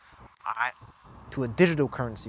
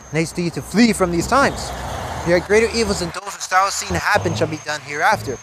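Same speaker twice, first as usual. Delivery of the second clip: louder, declaiming the verses, slowing near the end; scripture reading; 6 dB louder than usual. Acted. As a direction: thunder the warning out to the congregation. Reads scripture aloud.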